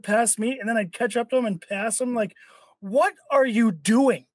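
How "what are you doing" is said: acted road-rage exasperation; exasperated outburst. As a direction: stretch it out in disbelief, then pitch up. Acted outburst at another driver.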